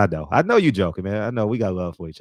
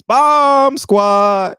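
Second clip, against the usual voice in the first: high, loud battle cry; sudden drawn-out high shout; imitated yell; 7 dB louder than usual. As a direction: holler it like an excited kid charging through the house. Imitates Lincoln yelling round the house.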